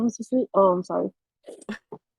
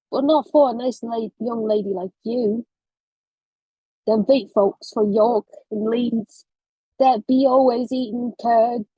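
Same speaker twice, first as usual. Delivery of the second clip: put-on light Yorkshire old man accent; British accent. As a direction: read it in a rural British accent. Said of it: "British accent".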